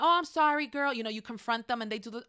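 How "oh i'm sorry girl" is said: put-on breezy, careless "sorry, girl" brush-off; mock half apology. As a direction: toss off a hollow, half-hearted sorry. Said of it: "the half apology".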